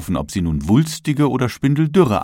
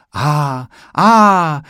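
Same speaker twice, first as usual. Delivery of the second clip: admiring ahs; 6 semitones higher, 6 dB louder than usual. A narrator's reading. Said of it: "kam es von allen Seiten".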